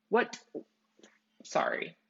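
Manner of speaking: ordinary talk, nothing marked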